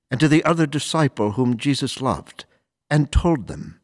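measured, formal scripture reading; solemn gospel reading. Acted. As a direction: read it like a priest at the ambo. Priest reads gospel aloud.

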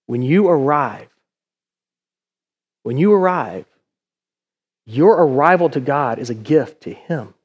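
low, soft and measured; tender reassurance. Real, not acted. Preacher tells congregation they are God's gift, tenderly hushed. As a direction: bring it down low and let it sink in.

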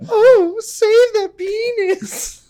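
high, slow woman's wail; girl voice. Retold or imitated. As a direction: copy the high drawn-out plea. Quoting wife's line.

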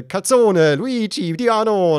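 loud, high fake Italian yelling; mock Italian gibberish. Toll booth voice yelling at him, loud mock Italian. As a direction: shout nonsense words like an angry Italian toll operator. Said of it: "sag ich jetzt einfach mal sowas wie".

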